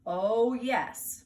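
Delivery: loud, rising firm reply; firm insistence. Acted. Mother insists against Fudge, loud and firm. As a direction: overrule the child flatly. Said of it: "mother insists".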